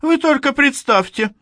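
high, loud outburst; exclaiming. A narrator's reading. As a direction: burst out in disbelief. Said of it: "воскликнул Берти".

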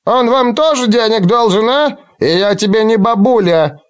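high, squawking crone voice; indignant old woman. Acted. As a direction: snap back like an offended hag. Old woman snaps back indignantly.

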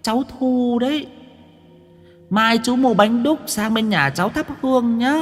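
high, thin ghostly voice; eerie ghost girl. Acted. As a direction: chill the listener like a ghost. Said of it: "quát bằng âm thanh nghe nó rợn cả người".

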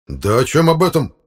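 loud, high-pitched furious question; enraged. Acted. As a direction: demand it in rising fury. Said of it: "рассвирепел Фёдор Игнатьевич". (рассвирепев, громко)

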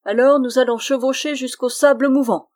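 loud, high-pitched threat; threatening. A narrator's reading. Goblin to the refusing friar, loudly threatening. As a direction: threaten the victim with the ride.

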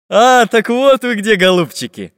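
loud, high gleeful gotcha. Acted. Pencil finds the robbers, loud gleeful voice. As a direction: pounce on them with glee. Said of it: "обрадовался Карандаш".

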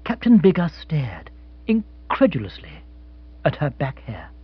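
usual speaking voice

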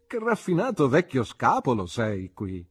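lively, teasing exclamation; exclaiming. A narrator's reading. Friend admiring the narrator's bachelor rooms, exclaiming. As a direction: exclaim it with animated pitch. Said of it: "esclamò".

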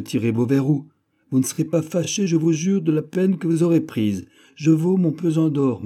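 plus haut et plus fort, il presse le vieillard; pressant et animé. A narrator's reading. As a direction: insiste avec fougue pour qu'on ouvre. D'Artagnan supplie d'ouvrir. (d'Artagnan presse le vieux serviteur d'ouvrir, voix haute et animée)

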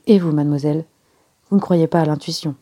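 ordinary delivery, nothing marked

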